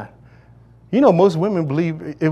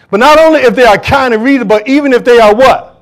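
thundering sermon read; preaching at full force; 7 semitones higher, 7 dB louder than usual. Real, not acted. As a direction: proclaim it to the congregation. Preacher calling out scripture.

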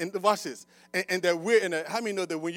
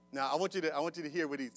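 usual speaking voice

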